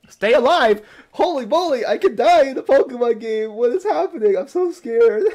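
high loud hammed-up fear; high loud mock-scared rant; mock panic. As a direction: play up being scared for laughs.

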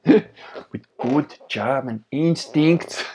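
a slow, loud hammed-up German voice; mock German accent.

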